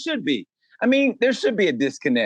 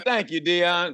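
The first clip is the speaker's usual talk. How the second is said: normal talking voice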